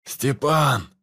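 loud call across the room; shouting a name. Acted. Calling out in a fight.

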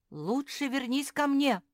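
high, loud demand. A narrator's reading. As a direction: insist on a return. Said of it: "потребовал Филипп".

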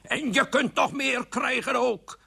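slow, high-pitched threat; fit of temper. Acted. King threatens wife in his tantrum, angry. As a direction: threaten it with every word stretched. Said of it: "de driftbui van de koning".

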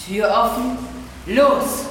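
loud high go-call; shouted start signal; urgent shout. Acted. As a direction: launch him out the door. Urging him out the door.

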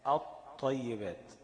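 الطيبات drawn out very slowly as aṭṭayyibāt, l silent; slow demo of assimilation. Shown as a demonstration. Teacher demonstrates to students, الطيبات slow, no l. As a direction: stretch الطيبات slowly, doubled ṭ and no l. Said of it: "مش ال،".

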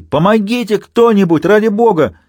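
high, loud cry for help; desperate shout. A narrator's reading. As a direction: yell up through the hatch. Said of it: "начал он кричать".

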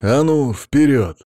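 high, loud command; sharp policeman's shout; barked order. A narrator's reading. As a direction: order the prisoner to get moving. Policeman shoves the prisoner onward.

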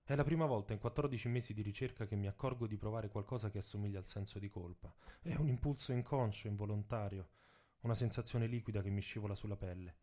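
usual speaking voice

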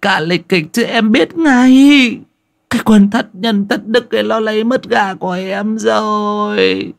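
high, loud shrieking lament; wailing old woman. Acted. Bà Bảy wailing to the neighbours over her stolen chicken. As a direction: wail it to the gathered neighbours. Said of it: "Bà Bảy vừa nghe vậy thì lại càng dãy nảy lên".

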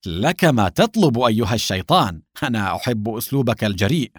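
ordinary delivery, nothing marked

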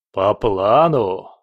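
slow loud furious roar. Acted. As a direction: roar the question back. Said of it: "Владыка заревел".

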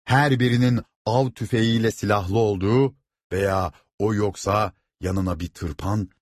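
ordinary delivery

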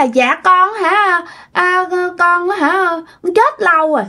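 put-on high, slow, drawn-out child voice; spirit child confessing. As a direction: answer haltingly as a dead child's spirit. Spirit answers who it is.

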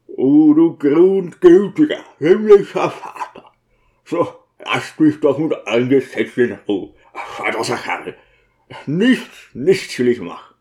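gruff outburst, loud at first, then subsiding; grieving deacon losing patience; exasperated deacon. Acted. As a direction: cry to heaven in exasperation.

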